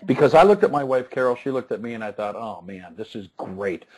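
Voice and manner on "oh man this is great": low, very soft resigned aside; frustrated, sarcastic. Retold or imitated. Dad looking at his wife over late homework, quietly frustrated. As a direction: mutter it low as a sinking inner thought. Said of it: "'Cause I was frustrated".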